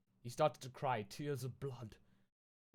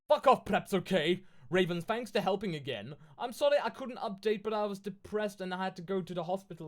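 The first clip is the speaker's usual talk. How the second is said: put-on higher, louder valley-girl author read; mock author voice; 6 semitones higher, 6 dB louder than usual. Streamer reading the author's note to viewers, mock teen-girl voice. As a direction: ham up the teen author gushing.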